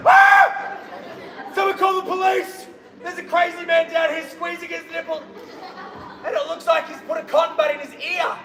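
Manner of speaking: high, loud cry of alarm; alarmed shouting. Acted. Bystander calling for police over a shirtless runner, alarmed shout.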